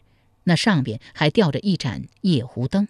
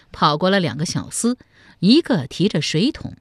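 plain reading voice

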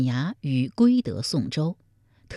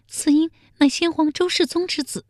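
much higher, small maid's voice; timid maid answering softly; 13 semitones higher than usual. A narrator's reading. The maid reveals the baby's father to the emperor, small and high. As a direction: answer meekly, afraid to say it. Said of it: "宫女小声回道".

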